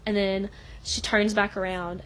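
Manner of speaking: ordinary delivery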